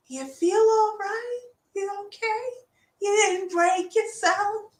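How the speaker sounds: high-pitched, coddling little voice. Retold or imitated. Coach consoling themselves after six minutes of yoga, high soft voice. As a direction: check in gently in a tiny high voice. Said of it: "listen to the softer voice".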